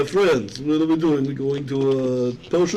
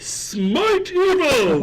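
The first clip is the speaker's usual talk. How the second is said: slow, high drawn-out war cry of Smite evil; paladin battle cry; 13 semitones higher, 3 dB louder, much slower than usual. Pretend. Player playing a paladin shouts his attack, drawn-out war cry. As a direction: bellow it like a holy warrior charging.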